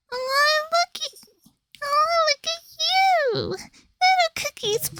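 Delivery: high, sly jester villain voice; high mocking trickster voice; Shadow Milk voice. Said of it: "warming up the Shadow Milk voice".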